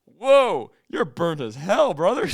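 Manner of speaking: booming, high, drawn-out southern holler; old southern gentleman. Retold or imitated. Old gentleman walks in on sunburnt stranger, southern holler.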